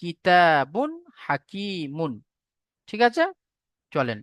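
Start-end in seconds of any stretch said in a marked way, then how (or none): 0.0-2.1 কিতাবুন হাকিমুন said slowly, the ন before হা clear; teacher repeats the example slowly; slow model reading. Shown as a demonstration. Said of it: "যেমন".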